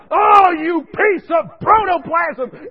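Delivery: loud, slow, high yelled insult; imitated yelling. Patient abusing the nurse, loud drawn-out yell. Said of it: "he's yelling at me".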